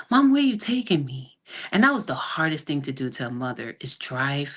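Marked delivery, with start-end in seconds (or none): 0.1-1.4 lightly voiced child, higher pitch; a hint of a child's high, questioning voice; imitating young son. Son asking mother in the car where they are going, lightly imitated child. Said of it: "My son is talking about".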